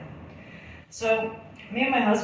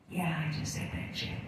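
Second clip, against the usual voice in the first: hushed unvoiced admission to a friend; whisper; 3 semitones lower, 10 dB quieter than usual. Confessing to her girlfriend at the table, whispered. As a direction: breathe it out like a guilty secret.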